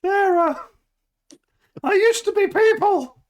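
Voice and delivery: very high, loud eerie parasol voice; high ghostly voice. Acted. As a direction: squeak it out like a trapped soul.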